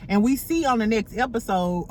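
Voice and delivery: usual speaking voice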